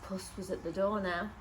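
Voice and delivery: plain reading voice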